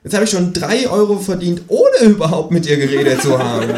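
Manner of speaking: high kangaroo voice, pleased with itself; the kangaroo gloats about its scheme; cheeky kangaroo. A narrator's reading.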